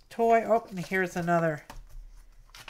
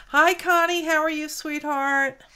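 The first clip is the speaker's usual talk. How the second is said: pet talk; 7 semitones higher, 4 dB louder than usual. Real, not acted. Greeting her pet affectionately.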